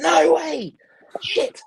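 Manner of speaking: high, loud shout of disbelief; shocked exclamation. Retold or imitated. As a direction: burst out in stunned amazement. Acted shocked reaction in the story.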